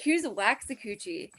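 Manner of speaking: "Waxahachie" said as "wax-a-KOO-chee"; mispronounced band name. Retold or imitated. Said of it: "I pronounced it Waxahachie, like, fifteen times".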